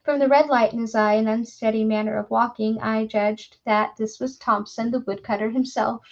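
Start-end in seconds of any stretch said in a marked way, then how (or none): none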